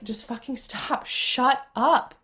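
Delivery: slow, firm command to her own mind; reenacting shutting down her inner critic, a touch stern; telling off inner voices. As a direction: draw a line against the voices, slowly. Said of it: "I had to be like".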